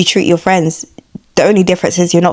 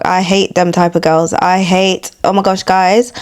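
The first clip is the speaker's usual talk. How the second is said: usual speaking voice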